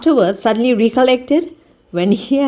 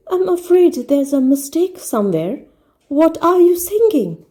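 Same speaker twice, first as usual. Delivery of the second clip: higher, bewildered question; puzzled pianist; 8 semitones higher than usual. A narrator's reading. Nervous pianist questioning the singer, higher. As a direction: ask nervously, pitched up.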